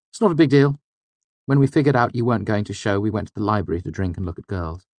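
put-on easygoing young friend, quick; breezy student brushing it off; Simon's voice. A narrator's reading. Simon's voice, shrugging it off.

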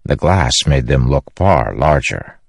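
ordinary delivery, nothing marked

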